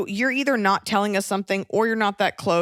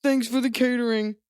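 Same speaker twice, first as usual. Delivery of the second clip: higher, slow, dopey careless-guest voice; mocking careless guest; 5 semitones higher than usual. Mocking a guest who brought no gift, slow and dopey. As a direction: play the clueless guest who forgot the gift. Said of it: "versus like".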